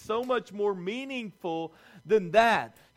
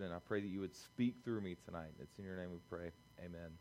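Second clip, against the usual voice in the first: soft, hushed opening prayer, much softer; 9 semitones lower, 8 dB quieter than usual. Acted. Pastor praying before the sermon to calm hearts, hushed prayer voice. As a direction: pray quietly and earnestly to calm the room.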